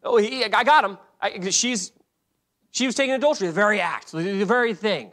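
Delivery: high, loud, eager telling on someone; tattletale. Acted. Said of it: "It's almost like a tattletale".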